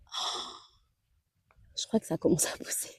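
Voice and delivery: soft whisper. Real, not acted. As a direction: whisper it close to the plants. Leaning over seedlings.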